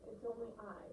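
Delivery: mumbled, expressionless reply; no character voice. Shown as a demonstration. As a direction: read the goat's line without acting. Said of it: "I wasn't using any voice".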